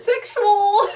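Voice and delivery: high, loud laughing quip. Real, not acted. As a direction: squeal it while laughing.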